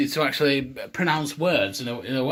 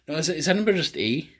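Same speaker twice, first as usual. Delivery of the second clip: normal talking voice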